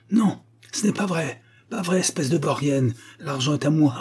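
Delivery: high, agitated protest; worked-up old man arguing; angry contradiction. A narrator's reading. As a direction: snap back in a quarrel. Old man quarrels over his money.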